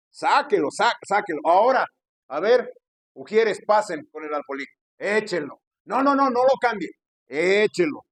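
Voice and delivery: loud, urgent collection orders; insistent mock shouting; imitated pushy pastor. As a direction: mimic a pastor commanding ushers and congregation. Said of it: "con ese acento y ese tono de voz".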